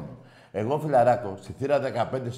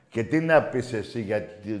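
ordinary talk

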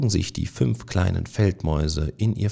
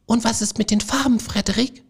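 much higher, fast, eager squeaky voices; excited mice; faster than usual. A narrator's reading. The eager mice beg Frederik for colours, high and fast. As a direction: tumble out the question in excitement. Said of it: "fragten sie aufgeregt".

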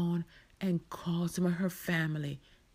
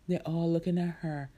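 plain reading voice

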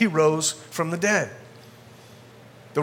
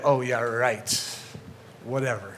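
sarcastic dismissal; 6 semitones lower, 6 dB quieter, slower than usual. Retold or imitated. Greeks dismissing the resurrection.

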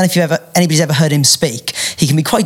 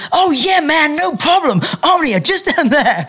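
high, breezy imitation of a stranger; put-on obliging voice, pitched way up; helpful passer-by; 11 semitones higher, slower than usual. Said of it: "And the guy goes".

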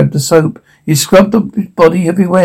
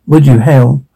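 usual speaking voice

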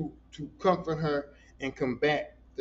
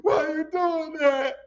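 mock crying; 11 semitones higher, 3 dB louder than usual. Real, not acted. Cries out at the film.

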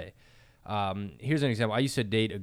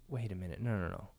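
calming self down; 7 dB quieter than usual. Retold or imitated. Calming himself down, retold.

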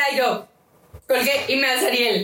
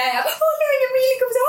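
put-on nervous high-pitched hello to a boyfriend's mother; squeaky phone voice; 14 semitones higher than usual. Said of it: "me hace más chillida".

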